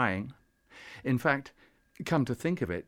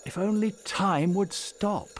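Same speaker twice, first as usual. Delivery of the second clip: high, slow, yearning plea; drawn-out, fervent wish, pitched high; heartfelt wish; 8 semitones higher, 3 dB louder than usual. A narrator's reading. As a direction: wish it aloud with all your heart. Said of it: "he said aloud with all his heart".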